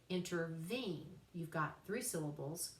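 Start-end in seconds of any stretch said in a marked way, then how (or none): 0.1-1.1 interVENE, weight on the third syllable; anapestic stress. Shown as a demonstration. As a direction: lean on VENE in "intervene". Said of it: "stress on the third one".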